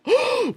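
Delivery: a sharp, very high fake gasp; exaggerated high gasp; mock gasp. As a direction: gasp in put-on shock. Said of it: "and I'm like".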